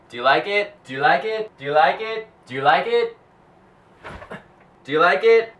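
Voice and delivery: high, loud teasing question; playful. Pretend. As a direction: tease the viewer, repeated. Repeated teasing question to camera.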